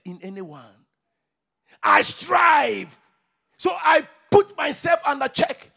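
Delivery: high, loud shouted preaching; fiery preacher shout; preaching at full force. Acted. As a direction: rouse the church with each line.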